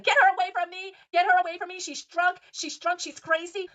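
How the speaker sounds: high, loud mock shriek of panic; panicked yell. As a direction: scream it like you're running from her. Heather fleeing drunk Whitney, yelling.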